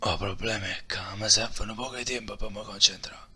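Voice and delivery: hushed whisper. Acted. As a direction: keep it secret from the enemy. Whispered plotting.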